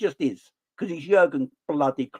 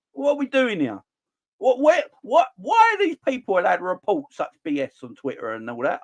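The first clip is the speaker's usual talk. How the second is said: exasperated rant; 6 semitones higher than usual. Real, not acted.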